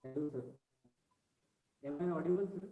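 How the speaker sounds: very faint voice far from the mic; faint, hard to hear; barely audible. Real, not acted. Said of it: "Your voice is very low".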